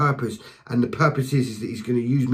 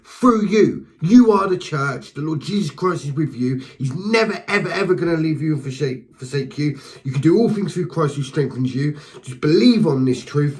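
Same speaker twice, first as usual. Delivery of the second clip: a sudden surge of passionate preaching; 3 semitones higher than usual. Real, not acted. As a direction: preach it out with full conviction. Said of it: "I'm very passionate".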